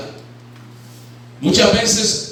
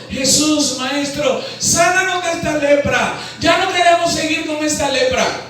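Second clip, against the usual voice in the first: higher, raised plea for healing; crying out; 6 semitones higher than usual. Retold or imitated. Preacher voices the lepers crying out to Jesus for healing. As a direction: lepers calling out to Jesus from afar. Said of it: "Y alzaron la voz diciendo".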